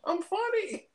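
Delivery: squeaky falsetto; mock pleading victim. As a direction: insist in a very high put-on voice. Victim tries joking with captors.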